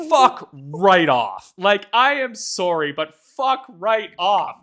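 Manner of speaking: fuck right off, slow and loud; slow emphatic outburst. Real, not acted. Ranting at the game company, slow loud exasperation. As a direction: drag each word out in disgust. Ranting at Nintendo about pop-in.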